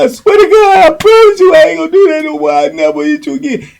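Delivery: high, hurried mock begging; imitated desperate pleading. Imitating the man promising Boo Boo he'll stop, frantic high pleading. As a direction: swear it in a high, frantic whine.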